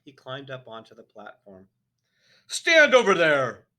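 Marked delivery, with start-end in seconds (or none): 2.5-3.7 slow, high, very loud command; furious headmistress command; barked order. A narrator's reading. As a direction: order him aside with full force. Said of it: "the Trunchbull ordered".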